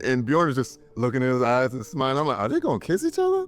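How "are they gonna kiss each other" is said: incredulous high squeal. Retold or imitated. Viewer wondering during romantic scene.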